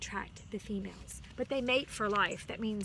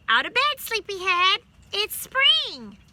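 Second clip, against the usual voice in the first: high, loud cheerful call; loud wake-up call; 9 semitones higher, 12 dB louder than usual. A narrator's reading. Rabbit thumping and calling up to the bird in her nest, high and loud. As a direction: holler it up into the tree.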